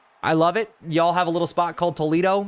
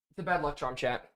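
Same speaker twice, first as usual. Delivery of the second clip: muttered; 11 dB quieter than usual. Real, not acted. Muttering after fumble.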